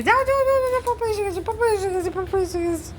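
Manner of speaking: high-pitched excited pet voice; squeaky, thrilled urging; excited egging-on. Pretend. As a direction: hype the dog up, very high and repetitive. Urging dog to greet dad.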